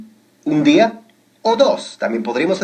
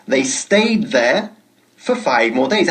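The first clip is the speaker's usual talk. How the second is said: "They stayed there for five more days" said slowly and clearly; slow model sentence; slower than usual. Shown as a demonstration. Teacher slowly modelling the sentence for learners. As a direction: spell it out slowly for students. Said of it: "Ojo con la pronunciación".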